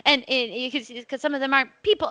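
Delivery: ordinary delivery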